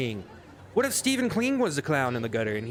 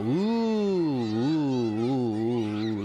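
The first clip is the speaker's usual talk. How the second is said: drawn-out teasing oohs; a wife swooning over her alpha man, hammed-up oohs; mock swooning oohs; 2 semitones lower, much slower than usual. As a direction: coo like an impressed fan, hammed-up.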